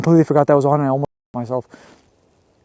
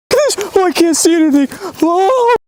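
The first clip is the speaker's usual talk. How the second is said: high frantic panic; lost and terrified; panicking in the dark; panicked. Real, not acted.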